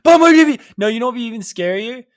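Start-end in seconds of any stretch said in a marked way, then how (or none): 0.0-0.6 very high, loud mock shout; commercial catchphrase yell. Fan throws his commercial back at him, loud yell. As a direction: blare the sales line at him.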